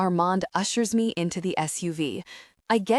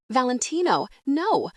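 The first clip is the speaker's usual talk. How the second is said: a raised, alarmed no; urgent cry; 6 semitones higher than usual. A narrator's reading. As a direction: cry out to stop him. Shayla pleads, stopping the attack.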